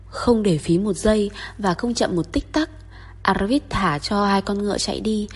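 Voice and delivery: usual speaking voice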